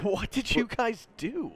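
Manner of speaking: high, slow, amused disbelief; incredulous. Acted. Student asking suspended friends what happened, incredulous. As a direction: draw it out, astonished, pitch way up.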